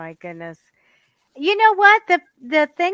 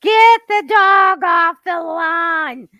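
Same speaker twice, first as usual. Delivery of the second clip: loud, higher, drawn-out mock scream; screaming neighbor; 5 semitones higher, 7 dB louder, slower than usual. Imitating a neighbor on the street, mock screaming. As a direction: yell it slowly across the yard.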